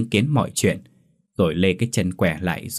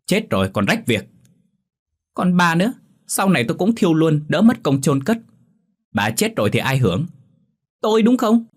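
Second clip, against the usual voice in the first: angry yelling; 8 semitones higher than usual. A narrator's reading. Son to his mother about selling the house, contemptuous yelling. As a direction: yell it down at her. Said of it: "Tâm gào lên".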